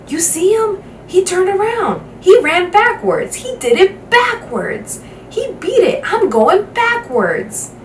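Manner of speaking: high, giddy rave from someone in the crowd, slowing at the end; excited spectator. Acted. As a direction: babble in disbelief about what you just saw. Spectator raving about backwards run.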